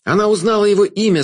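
excited cry from the crowd; crowd shouting. A narrator's reading. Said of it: "закричали в толпе".

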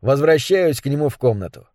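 plain reading voice

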